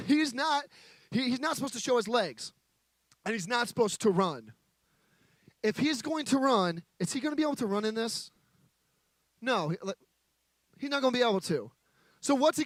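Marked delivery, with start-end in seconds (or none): none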